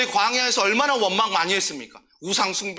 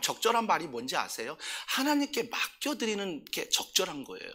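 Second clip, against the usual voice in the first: ordinary talk, nothing marked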